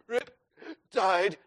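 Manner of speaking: weak, broken mock gasps; acted exhausted swimmer; spent gasping. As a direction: choke out single words, half-drowned. Acts himself spent on the sand.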